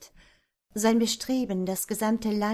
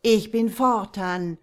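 undead king; 8 dB louder than usual. Acted. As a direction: command them to kneel. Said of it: "schrie er".

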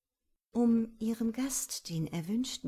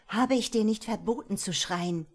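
sharp, louder scolding, a bit higher; barking reprimand; 4 semitones higher, 7 dB louder than usual. A narrator's reading. Boss Cory snaps at her cowering secretary, sharp loud reprimand. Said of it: "herrschte sie ihn an".

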